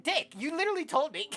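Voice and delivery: high, loud indignant retort; mock outrage. Player scolding the game character, mock outrage. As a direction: exclaim it in loud, high protest.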